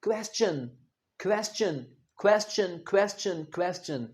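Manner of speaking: क्वेश्चन said "kwestion" with a clear w and t-ch; correct pronunciation. Shown as a demonstration. Teacher models the right way: क्वेश्चन as kwes-chun. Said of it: "क्वेश्चन नहीं है".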